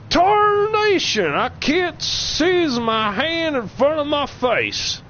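high, slow cartoon cowboy drawl; Yosemite Sam voice. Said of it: "my Yosemite Sam impression".

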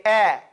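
"Eh" with the mouth wide open. Shown as a demonstration. Teacher prompting the student, wide-open "Eh". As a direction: keep it open for "Eh". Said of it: "Open your mouth. Say".

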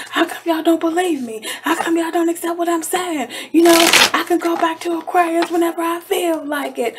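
mock indignant ex. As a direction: play the ex insisting nobody believes him. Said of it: "They're telling everybody else, like".

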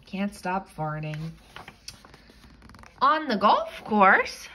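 ordinary delivery

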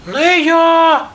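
very high mouse squeak. A narrator's reading. Mouse refuses to knead the dough, squeaking. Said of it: "пищить верть".

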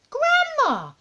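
very high, loud outcry; high-pitched boyish horror; appalled little boy. Acted. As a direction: exclaim in a shocked child voice.

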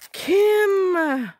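delighted call. Real, not acted. Crafter thanking the store owner, calling out with joy. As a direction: call her name in sheer delight.

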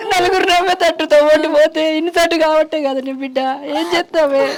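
high, wailing grief; mother crying out her helpless shame; tearful lament. Acted. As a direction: end the lament in sobs. Mother grieves over family's public shame.

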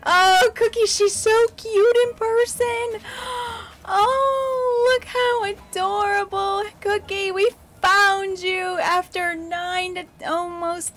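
high, gushing delight with gasps; breathless, adoring excitement; gushing squeal. Real, not acted. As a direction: coo over her like a longed-for prize. Gushing over the new villager.

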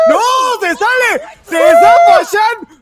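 screaming high and loud with a laugh; ecstatic shouting. Real, not acted. Cheering a last-second survival, ecstatic screaming. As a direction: erupt as the player escapes death. Clutch comeback in live match.

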